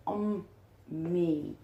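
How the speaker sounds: usual speaking voice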